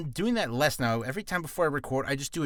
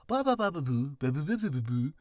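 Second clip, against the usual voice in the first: warm-up babble. Shown as a demonstration. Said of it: "And I go".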